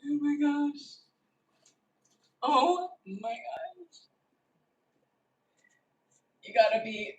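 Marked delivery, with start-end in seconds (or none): 0.1-4.0 awed. Real, not acted. Overwhelmed by the result.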